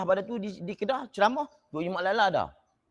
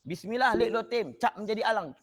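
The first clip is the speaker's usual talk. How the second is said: high, measured spell recitation; incantation demo; 6 semitones higher than usual. Shown as a demonstration. Teacher demonstrating the charm, measured incantation. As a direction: recite the charm deliberately, voice raised in pitch. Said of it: "mu jekah dia".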